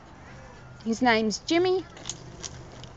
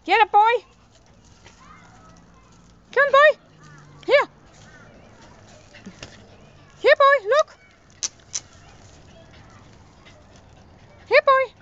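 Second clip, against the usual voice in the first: excited dog call; 12 semitones higher than usual. Real, not acted. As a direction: rev the dog up to fetch. Calling dog to fetch.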